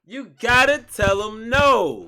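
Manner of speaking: high, loud, slow shout. Acted. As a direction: bellow it out like a hype man. Echoing the clip at full volume.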